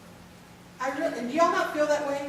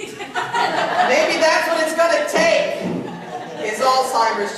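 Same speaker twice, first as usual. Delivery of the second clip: slow and louder, laughing through the words; 6 semitones higher, 8 dB louder, slower than usual. Real, not acted. Joking to the caregivers group, laughing. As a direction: laugh as you land the joke.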